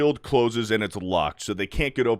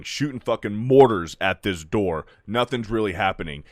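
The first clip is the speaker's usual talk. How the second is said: normal talking voice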